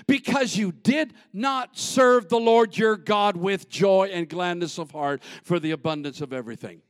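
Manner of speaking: loud, slowed scripture proclamation; scripture read at full force. Acted. Pastor reading the verse to the church, proclaiming. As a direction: proclaim the verse over the music.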